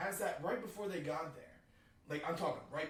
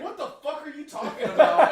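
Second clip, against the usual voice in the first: higher, louder, laughing; incredulous shout; 11 semitones higher, 16 dB louder than usual. Retold or imitated. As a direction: bark in disbelief. Buddy incredulous, quoted.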